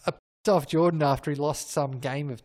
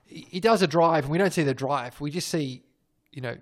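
usual speaking voice

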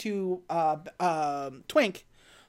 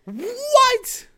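high, loud stunned what; squeaky disbelief at a dumb choice; incredulous what; 6 dB louder than usual. Real, not acted. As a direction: react in disbelief, voice jumping up. Host reacts in disbelief.

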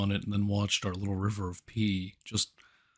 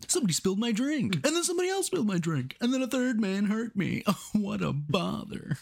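much higher, fast whining list of woes; whiny bar guy; 11 semitones higher, faster than usual. Pretend. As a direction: rattle off the injustices in a put-upon squeak. Playing the bumped bar guy.

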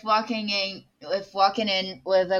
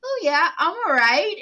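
a hint of forced cheer, higher; fake reassurance; 7 semitones higher than usual. Man hiding his pain from his husband, forced cheer. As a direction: say it with forced brightness. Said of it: "So he's like".

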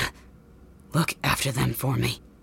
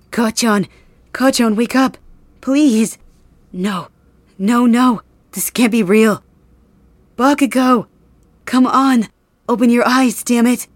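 high, loud frantic cries to wake him; desperate pleading; 7 semitones higher, 6 dB louder than usual. Acted. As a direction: shake him awake in panic. Finds friend's body, begs him wake.

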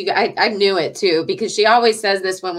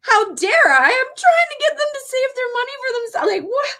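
much higher, whiny mock protest; indignant self-quote. Daughter retells protesting to her mom, high whiny mock indignation. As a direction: squeak out your own indignation. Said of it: "I was like".

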